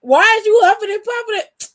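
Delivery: loud, high-pitched demand; mock confrontation. Acted challenge to husband's sighing.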